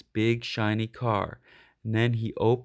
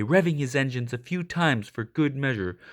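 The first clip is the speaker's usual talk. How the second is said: ordinary delivery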